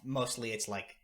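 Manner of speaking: ordinary delivery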